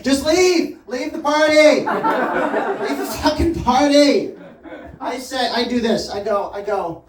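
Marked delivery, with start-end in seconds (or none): 0.0-4.3 loud, higher-pitched yell to get out; exasperated yell. Acted. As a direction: yell at them to leave the party. Acted outburst telling guest to leave.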